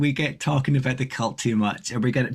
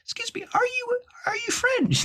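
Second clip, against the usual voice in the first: much higher, polite French lady voice; imitating French lady. Retelling a French lady's question, voiced much higher. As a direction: lift way up into a dainty, curious lady. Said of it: "She goes".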